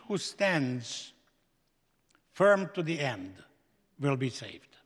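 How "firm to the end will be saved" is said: normal talking voice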